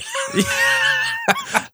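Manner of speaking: high, shrieking burst of real laughter. Real, not acted. Laughing at the mining tunnels idea.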